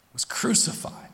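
a hushed, grave whisper. Real, not acted. Solemn list of Jesus' suffering.

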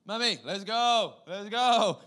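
high, drawn-out insistent call; impatient toddler. Retold or imitated. Little boy sick of waiting calls mum, high and impatient. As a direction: whine to leave like a small boy. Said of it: "he's sick of the situation and he wants to change".

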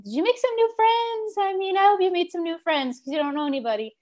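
high, singsong imitation of mom; hopeful mom. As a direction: act out a mother fishing for good news. Mother's hopeful question, acted out.

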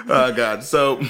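usual speaking voice

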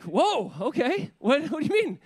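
high, loud startled reaction; taken aback. Retold or imitated. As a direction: retell a dad's shocked double take. Dad acts his startled reaction.

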